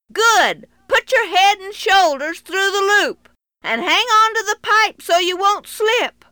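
loud high instructions; calling out. Acted. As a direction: call down firm directions. Cowboy instructs boy loudly.

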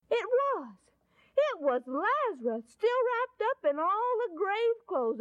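high-pitched, excited storytelling; astonished narrator at the climax; amazed reveal. A narrator's reading. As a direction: break off, then burst out with the wonder. Amazed reveal of risen Lazarus.

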